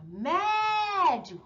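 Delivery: médio with MÉ held long and high; a long, high MÉ opening "médio"; stronger stressed syllable. Shown as a demonstration. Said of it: "o acento é a mais forte".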